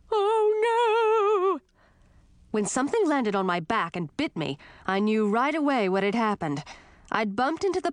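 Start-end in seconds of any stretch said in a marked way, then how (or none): 0.1-1.6 high loud wail of alarm; dismayed cry. Acted. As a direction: shriek it as things fall. Falling into skull pile.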